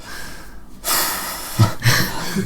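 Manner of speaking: long, breathed-out weary sigh. Retold or imitated. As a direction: sigh as if enduring a long night. Imitates lying awake waiting it out.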